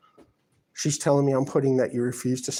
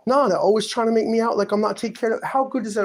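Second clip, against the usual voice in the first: agitated; 8 semitones higher than usual. Real, not acted. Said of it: "I'm not trying to talk like this".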